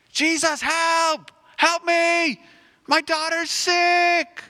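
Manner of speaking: high, loud desperate call across a crowd; frantic father crying out for help, loud and high. Acted. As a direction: shout his name to be heard. Father calling through crowd.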